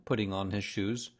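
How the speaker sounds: ordinary delivery, nothing marked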